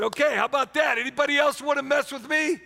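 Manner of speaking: proud king daring anyone to challenge him; boastful king. Acted. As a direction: gloat at the whole court. King gloats after the execution.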